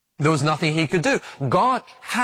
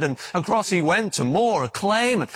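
usual speaking voice

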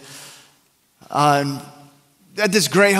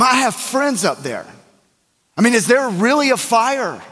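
higher, anxious pleading; freaking out; 6 semitones higher than usual. Retold or imitated. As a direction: act out a friend frantic about his friends. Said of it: "he's just freaking out".

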